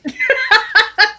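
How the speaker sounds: shrieking burst of laughter; high squealing laugh. Real, not acted. Laughing at the abs.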